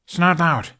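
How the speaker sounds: calling a name across the stands. Acted. Hiccup calling to Snotlout at the railing. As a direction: get his attention from a distance. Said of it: "Hiccup called".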